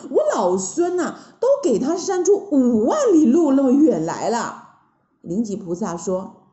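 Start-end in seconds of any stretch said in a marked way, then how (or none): 0.1-4.6 loud, high, indignant monkey hero; big, animated storybook monkey grumble; exasperated Monkey King. Acted. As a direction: complain loudly as the Monkey King. Voices Sun Wukong complaining.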